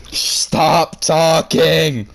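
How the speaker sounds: slow each word right down; stretched-out stern command; very slow, deliberate. Real, not acted. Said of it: "Give their, give the back".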